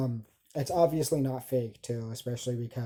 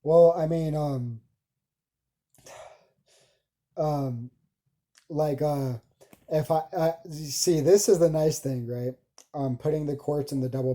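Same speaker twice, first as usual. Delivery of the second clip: loud excited talk; 3 semitones higher, 8 dB louder than usual. Real, not acted. Speaker grows loud, excited.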